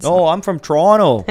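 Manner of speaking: high, loud put-on Canadian accent; hammed-up Canadian accent. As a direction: insist you're from Toronto in a Canadian accent. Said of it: "the accent I cannot do".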